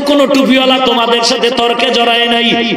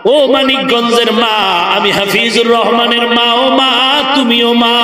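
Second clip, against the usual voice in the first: ordinary talk, nothing marked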